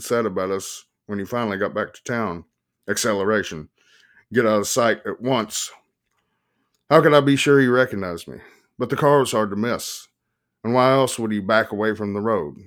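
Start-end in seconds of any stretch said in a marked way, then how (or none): none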